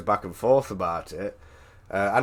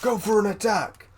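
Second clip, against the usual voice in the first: angry shout; 11 semitones higher than usual. Retold or imitated. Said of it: "he was like".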